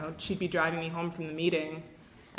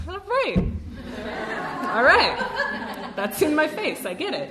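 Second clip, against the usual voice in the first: high, loud, laughing acceptance; laughing surrender; 9 semitones higher, 6 dB louder, slower than usual. Retold or imitated. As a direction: give in through laughter, pitched way up. Mock-resigned retold reaction amid laughter.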